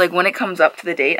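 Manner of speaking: ordinary delivery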